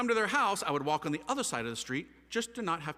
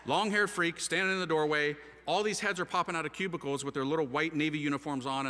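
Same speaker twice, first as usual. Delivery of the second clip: ordinary talk, nothing marked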